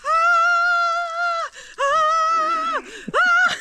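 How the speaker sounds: high sharp screams from icy water; cold shock screams. Real, not acted. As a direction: yelp hard at the freezing cold.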